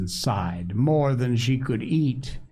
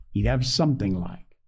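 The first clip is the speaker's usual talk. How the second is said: usual speaking voice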